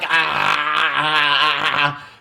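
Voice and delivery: monotone stuttered "ah-ah-ah" noise; one droning, chopped-up ah, mimicking overthinkers; mock overwhelmed "ah". Mimicking students who overthink everything, mock overwhelmed drone. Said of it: "They're like".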